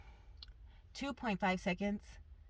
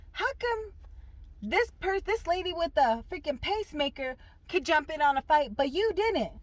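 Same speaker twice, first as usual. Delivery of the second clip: incredulous; 9 semitones higher, 3 dB louder than usual. Retold or imitated. Confronting mom.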